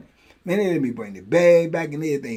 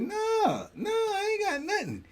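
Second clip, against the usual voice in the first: much higher, cracking denial; squeaky denial; 8 dB quieter, slower than usual. Retold or imitated. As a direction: deny it in a high nervous squeak. Denying having liquor.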